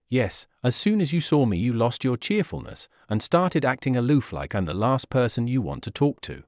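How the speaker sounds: plain reading voice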